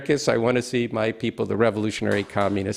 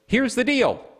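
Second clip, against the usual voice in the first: high-pitched, lightly mocking take on Biden's catchphrase; imitating Biden; 12 semitones higher than usual. Quoting Biden's pet phrase to the audience, light mock Biden voice. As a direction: pitch it up and toss it off like Biden's favorite line.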